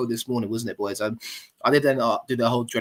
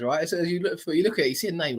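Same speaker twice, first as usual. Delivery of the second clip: ordinary delivery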